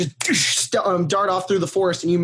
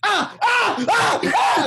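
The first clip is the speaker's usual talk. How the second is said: mock screaming; 13 semitones higher, much slower than usual. Creatures all screaming together, high panicked squeals. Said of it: "they're all just all screaming".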